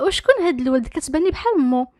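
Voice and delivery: plain reading voice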